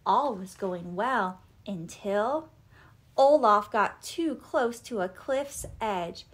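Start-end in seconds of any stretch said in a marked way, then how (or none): none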